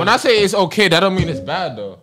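insistent protest. Real, not acted. Defending his rating in argument.

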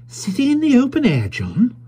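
put-on high, surprised young wife; high-pitched wife surprised at her husband; young wife's voice. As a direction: ask it as a startled young wife.

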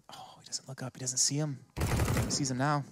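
hushed tense whisper. Real, not acted. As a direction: drop to a whisper for the tension. Hushed tense moment in play.

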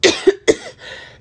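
hard forced cough to clear the throat; loud high forced cough; deliberate cough. Real, not acted. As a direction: cough it out on purpose. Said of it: "so I gotta cough again".

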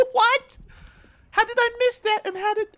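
high, loud outrage; incredulous at his own miss; disbelieving shout. Real, not acted. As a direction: cry out at the miss. Missed shot disbelief.

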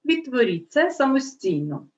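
normal talking voice

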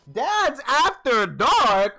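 very slow, loud, stretched disbelief; incredulous drawn-out read; stunned exclaim. Real, not acted. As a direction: drag the name out in shock. Reading enemy name in disbelief.

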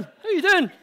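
high, loud, breezy hello to passing cars; cheery greeting. Retold or imitated. Greeting the slowing cars mid-dance, cheery and loud. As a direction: beam at the drivers and call it out. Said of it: "I was going".

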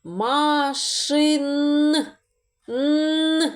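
teacher isolating Н; sounding out Н. Shown as a demonstration. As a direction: hum the lone Н. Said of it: "Далі слухайте".

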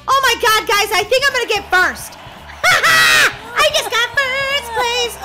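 loud, high victory cry; thrilled shout. Real, not acted. Gamer winning a race to viewers, thrilled shout. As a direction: crow over the win.

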